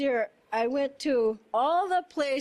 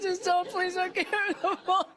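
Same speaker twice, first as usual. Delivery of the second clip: pleading through nervous laughter; half-laughing please don't; 5 semitones higher than usual. Retold or imitated. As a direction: beg while giggling.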